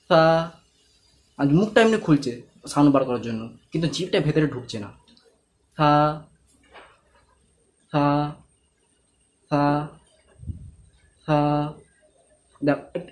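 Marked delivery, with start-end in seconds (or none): 0.1-0.5 সা with the tongue tip out, lightly touching the upper teeth; correct tha. Shown as a demonstration. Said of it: "সাউন্ডটা শোনো". 5.8-11.4 সা with the tongue tip out, lightly touching the upper teeth; correct tha. Shown as a demonstration. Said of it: "সাউন্ডটা শোনো".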